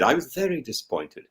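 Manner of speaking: a soft, deflated echo. Real, not acted. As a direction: let it fall away softly.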